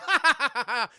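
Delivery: high, loud staccato fake laugh; punched-out performed guffaw; mock belly laugh. Gang member laughing off the kid's question, mock laugh.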